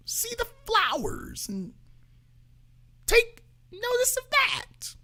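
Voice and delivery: high, drifting mock voice that fades into a whisper; mock Michelle Obama. Mocking the podcast host, high and drifting into a whisper. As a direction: parody her dreamy podcast voice, trailing off. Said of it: "She's talking all low and slow".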